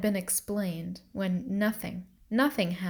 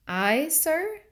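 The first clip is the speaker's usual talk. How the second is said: surprised; 8 semitones higher than usual. A narrator's reading. Accused girl startled.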